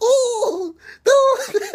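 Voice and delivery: very high, laughing imitation of cries; mock French yelps. Storyteller laughing while imitating the Frenchman's cries, high mock yelps. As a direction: squeal it through laughter. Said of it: "laughing my ass off just by the French".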